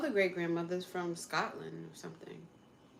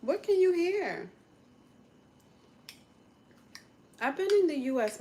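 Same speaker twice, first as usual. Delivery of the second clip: incredulous; 9 semitones higher, 8 dB louder than usual. Real, not acted. Disputing claim of accent.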